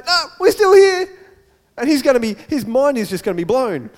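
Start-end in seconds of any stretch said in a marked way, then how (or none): 0.0-1.1 high, loud shout to someone far off; calling out. Acted. Prisoners calling to the jailer, high urgent shout. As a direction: call out urgently to stop him. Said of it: "we'll say".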